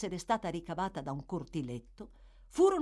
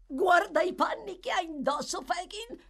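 put-on high, loud boyish delight; excited exclamation; 12 semitones higher, 5 dB louder than usual. A narrator's reading. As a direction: point and crow with glee. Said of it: "esclamò Charlie".

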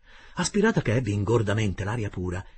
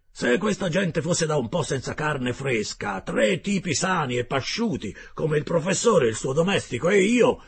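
gruff harpooner Ned; 6 semitones higher, 6 dB louder than usual. Acted. Ned Land's voice in dialogue.

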